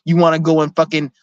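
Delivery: ordinary talk, nothing marked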